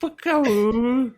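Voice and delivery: high, slow, drawn-out creature call; silly animal call. Pretend. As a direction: stretch it out like a cartoon creature. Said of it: "we'll have to do our own".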